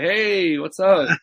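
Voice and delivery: loud, high, showy hello; put-on hearty greeting. Wrestler turning round to greet fans, put-on hearty call. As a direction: switch on a big greeting for the fans.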